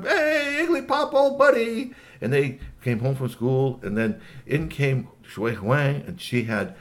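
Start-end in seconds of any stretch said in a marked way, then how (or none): 0.0-1.9 put-on high, loud little-boy voice; excited kid greeting a pal, pitched way up. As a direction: holler a happy hello like a small child. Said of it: "little Clem".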